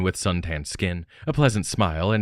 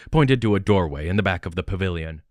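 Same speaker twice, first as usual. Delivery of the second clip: usual speaking voice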